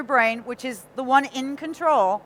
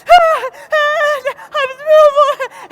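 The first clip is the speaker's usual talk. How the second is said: imitating panicked stammering, much higher and louder; retold panic; 13 semitones higher, 8 dB louder, much slower than usual. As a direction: act out the frightened stammer, half laughing. Panicking at the police officer.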